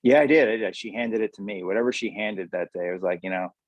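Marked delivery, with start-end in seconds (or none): none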